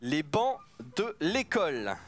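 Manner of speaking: slow, deliberate dictation; each word set apart; dictating slowly. Acted.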